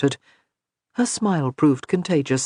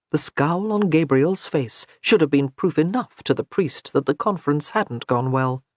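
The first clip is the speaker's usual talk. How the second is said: ordinary delivery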